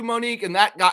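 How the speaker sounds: ordinary talk, nothing marked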